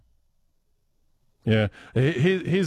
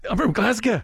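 put-on Glaswegian accent; 9 semitones higher than usual. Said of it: "I can't do John's accent".

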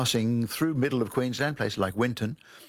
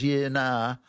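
drawn-out, hesitant yeah-nah; vacillating; 3 semitones higher than usual. Shown as a demonstration. Guest shows host an unsure answer, vacillating. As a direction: hedge it, toning down the response. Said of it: "sort of toning down or vacillating".

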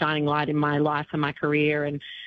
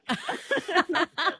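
high laugh; 13 semitones higher than usual. Real, not acted. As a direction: burst out laughing, high and delighted. Amused by Dolly's joke.

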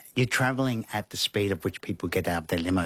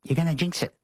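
much higher, snappy scolding; Lizzie hushing; 9 semitones higher, faster than usual. Retold or imitated. Lizzie scolding him at the Oscars, higher and snappy. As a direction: play Lizzie telling him off. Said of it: "She said".

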